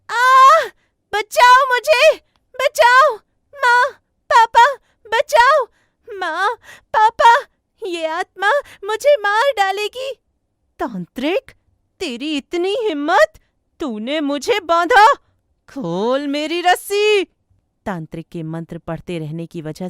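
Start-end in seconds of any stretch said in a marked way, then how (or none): 0.1-10.2 very high, loud cries for help; screaming in terror. Acted. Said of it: "पाखी चिल्लाने लगती है". 10.8-17.3 high, loud outraged demand; enraged ghost. Acted. Bound ghost defies the exorcist.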